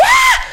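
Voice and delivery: short high scream; retold scream. Retelling her scream at a shape in the dark office, high shriek. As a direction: shriek it out, startled. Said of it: "Y grité así".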